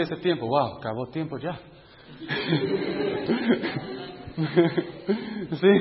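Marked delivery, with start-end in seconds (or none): 2.3-3.9 soft laugh. Real, not acted. Laughs as time runs out.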